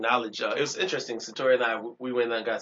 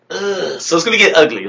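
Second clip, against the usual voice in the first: loud; 6 semitones higher, 15 dB louder than usual. Real, not acted. Hyped loud warning.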